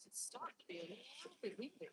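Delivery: soft unvoiced whisper; barely breathing the words out. Real, not acted. Whispering to viewers about the wind. Remark on windy weather.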